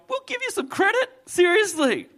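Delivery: high, pleading mock voice; hammed-up whiny pleading voice; pleading Gideon. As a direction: beg like a nervous Gideon. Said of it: "Think about Gideon going".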